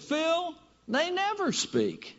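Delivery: high, slow, put-on wife voice, drawn out; mock wife voice. Wife setting husband straight, high mock voice.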